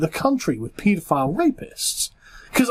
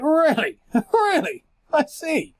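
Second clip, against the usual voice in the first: incredulous; 8 semitones higher, slower than usual. Real, not acted. Sarcastic disbelief.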